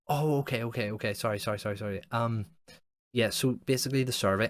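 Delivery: mock flustered caller. Imitating the survey guy apologising but pressing on. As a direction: apologise over and over, then carry on.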